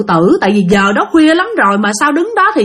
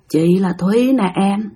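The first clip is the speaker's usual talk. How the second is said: slow, low-ish creepy murmur, lightly voiced; a boyfriend taken over by a dead woman, slow; possessed eerie voice; 3 semitones lower, 3 dB quieter, slower than usual. Possessed boyfriend speaking as a ghost, a hint of slow eerie voice. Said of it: "Cái giọng của ảnh rất là kháng, nó khác mà nó trầm trầm, nó ồn ồn".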